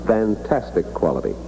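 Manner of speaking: normal talking voice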